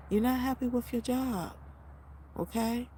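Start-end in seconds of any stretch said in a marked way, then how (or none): none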